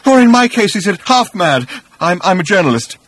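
loud and high, easing down with a stammer; agitated, faltering as it settles; half-mad journalist, overwrought. A narrator's reading.

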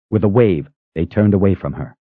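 usual speaking voice

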